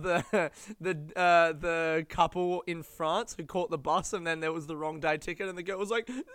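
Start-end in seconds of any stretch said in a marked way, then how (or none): 6.1-6.3 very high, loud crying "No!" that breaks into a laugh; devastated wail. Retold or imitated. The girl who caught the wrong bus, wailing in despair. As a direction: howl it like a heartbroken child, then crack up. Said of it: "That was me".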